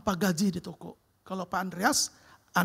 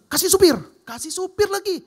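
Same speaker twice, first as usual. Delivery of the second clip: higher and louder, eager command; excited boss order; 10 semitones higher, 8 dB louder than usual. Retold or imitated. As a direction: boss generously insisting.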